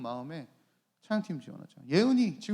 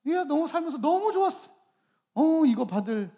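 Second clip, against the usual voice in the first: higher, gushing happy exclamation; re-enacted giddy joy; acted delight; 9 semitones higher, 5 dB louder than usual. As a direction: gush as if thrilled to be buying a gift. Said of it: "너무너무 좋은 거예요".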